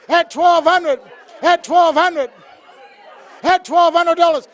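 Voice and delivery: rapid auction chant, calling bids; auctioneer calling. Acted. Runs the charity auction.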